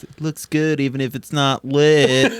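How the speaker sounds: lightly put-on daughter's voice; a hint of movie-daughter impression; imitating the daughter. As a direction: quote the daughter's peculiar delivery. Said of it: "just the way she says it".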